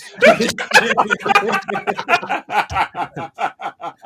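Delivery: big burst of laughter; loud high laugh breaking out. Real, not acted. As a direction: crack up laughing loudly. Laughing at the joke.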